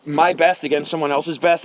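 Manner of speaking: usual speaking voice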